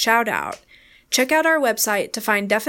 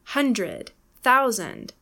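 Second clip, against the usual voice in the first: HUNdred, THOUsand; hundred and thousand stressed at the front; first-syllable stress; much slower than usual. Shown as a demonstration. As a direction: punch HUN and THOU, let the ends fall. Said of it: "Stress is on the first syllable".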